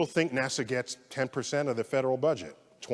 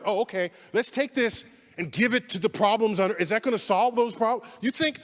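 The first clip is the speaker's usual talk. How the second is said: angry rant; 9 semitones higher than usual. Real, not acted. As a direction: let the indignation boil over. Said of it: "I'm angry".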